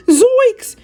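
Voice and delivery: very high, loud yelp of fright; scared cry. A narrator's reading. Shaggy spotting danger, high loud yelp. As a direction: shriek it in panic. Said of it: "cried Shaggy".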